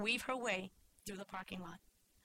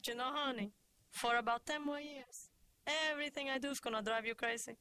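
put-on accented mother, slightly raised voice; smiling Cuban mother; 4 semitones higher than usual. A narrator's reading. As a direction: explain fondly to your grown daughter. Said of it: "smiled and said".

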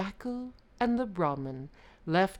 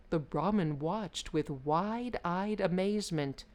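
usual speaking voice

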